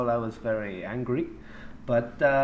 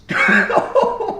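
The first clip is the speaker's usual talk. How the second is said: burst of laughter; 12 dB louder than usual. Real, not acted.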